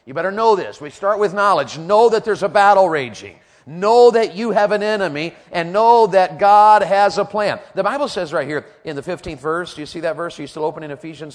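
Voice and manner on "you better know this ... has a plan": high, loud pulpit exhortation; forceful preaching. Real, not acted. Preacher rallying listeners for battle, forceful preaching. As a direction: hammer each "know" at the congregation.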